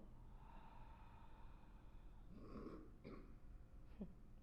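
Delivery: slow, voiceless breath out through the mouth; empty the lungs in one long sigh; long airy exhale; long letting-go exhale. Shown as a demonstration. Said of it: "let it go".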